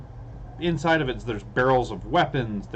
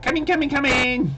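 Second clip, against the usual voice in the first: high, tiny, eager kobold voice; pixie; 13 semitones higher, 3 dB louder than usual. Acted. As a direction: squeak it out like a tiny shopkeeper calling from the back. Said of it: "you hear a little voice from the back".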